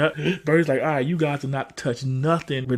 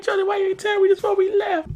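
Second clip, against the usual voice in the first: high-pitched imitated complaint; mock exasperated uncle. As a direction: pitch it way up, fed up with the kid.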